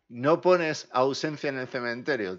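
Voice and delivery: "no pones ausencia en el cementerio" leído fuerte y lento, con énfasis en "pones"; comentario leído en voz alta. Real, not acted. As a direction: lee el comentario despacio y con fuerza. (lee en voz alta el comentario del ruso, fuerte y pausado)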